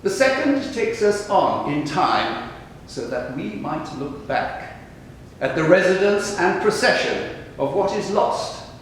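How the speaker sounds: solemn, spacious verse delivery; poetry recitation. Acted. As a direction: declaim each line deliberately, letting the phrases hang. Reads own poems aloud after the talk.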